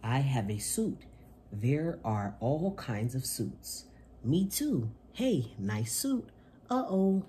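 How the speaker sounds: bright, lively picture-book reading voice; reading to children. Acted. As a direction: read it to little kids with gusto. Reads picture book aloud.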